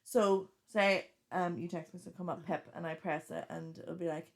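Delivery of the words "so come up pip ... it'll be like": usual speaking voice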